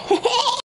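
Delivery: short high-pitched giggle; quick bright laugh. Real, not acted. Giggles at fast room service.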